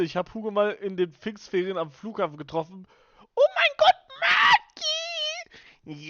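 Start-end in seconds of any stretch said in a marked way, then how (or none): 3.3-6.1 high, loud shriek of joy; excited shriek. Real, not acted. Streamer shrieking as a friend joins the stream, high and loud. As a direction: squeal the name at the top of your voice.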